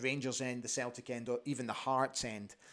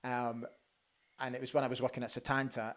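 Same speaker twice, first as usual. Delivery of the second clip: ordinary delivery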